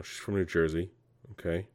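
usual speaking voice

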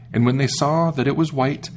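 ordinary delivery, nothing marked

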